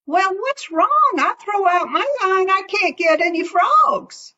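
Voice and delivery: high puzzled critter voice; a high raccoon, baffled; Brer Raccoon. Acted. Raccoon voiced in folk tale.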